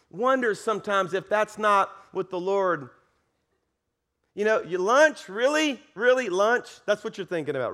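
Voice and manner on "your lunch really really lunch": louder, higher mock incredulity; exasperated disbelief. Panicked dad to people thinking of lunch, acted-out incredulous scolding. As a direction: throw it back at them, appalled. Mocking bystanders during frantic search.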